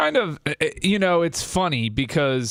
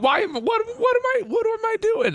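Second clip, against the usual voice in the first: much higher, a startled falsetto self-quote; a squeaky, bewildered falsetto, mocking yourself; mock bewildered squeak. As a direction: play your future rich self, suddenly baffled, in a squeaky voice. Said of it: "I'm like".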